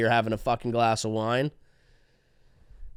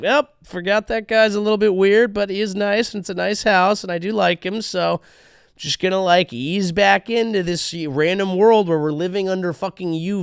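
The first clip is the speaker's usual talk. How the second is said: higher, breezy resigned voice; girlfriend voice; 6 semitones higher than usual. Pretend. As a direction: shrug it off lightly. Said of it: "she comes back and is like".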